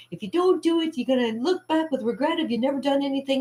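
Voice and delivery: high-pitched quoted lecture; advice-giver voice. Retold or imitated. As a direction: imitate people warning about regret. Quotes people giving advice.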